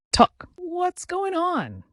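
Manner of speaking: high, slow, stretched-out alarm; alarmed outburst. Acted. A man thrown off balance by his friend's news, crying out in high, slow alarm.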